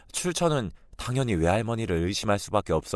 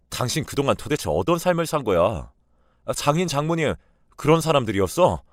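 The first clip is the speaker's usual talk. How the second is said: louder and higher, demanding answers; angry husband; 5 semitones higher, 4 dB louder than usual. Acted. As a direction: hurl the question at her in disbelief. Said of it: "불같이 화를 내며". (아내에게 따지는 남편의 성난 목소리)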